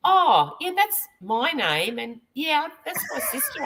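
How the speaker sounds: high, slow realization breaking into a laugh; dawning surprise. Retold or imitated. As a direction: play the guy who just caught on. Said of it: "and goes".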